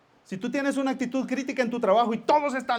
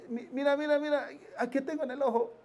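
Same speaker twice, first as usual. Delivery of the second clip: fussy husband; 6 semitones higher, 5 dB quieter, faster than usual. Retold or imitated. Husband asks wife, acted.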